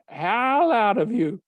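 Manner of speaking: high, loud, drawn-out cry; acted shouted warning; emphatic warning. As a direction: hammer it home as the fired-up priest. Teacher warns boy about bullies.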